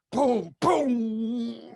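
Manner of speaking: imitated booms. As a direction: boom like a drum hit. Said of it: "And he said".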